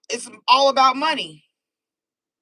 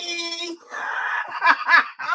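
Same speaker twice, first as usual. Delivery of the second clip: high burst of laughter at own joke; laughing; 8 semitones higher than usual. Real, not acted. Tarot reader laughing at her own joke. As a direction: crack up at your own words.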